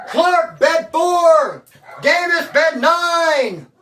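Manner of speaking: high, loud loudspeaker roll call; calling out bed numbers over the prison speaker, high and loud; mail call over speaker. Retold or imitated. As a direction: bark names like a guard on the PA. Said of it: "Just mail call over the speaker".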